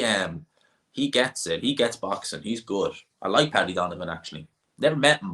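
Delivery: ordinary talk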